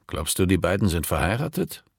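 plain reading voice

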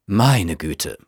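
low, weary exclamation; sighed. A narrator's reading. Hostess sighing to her guests about her son, sighed. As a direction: let it sink like a sigh. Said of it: "seufzte sie".

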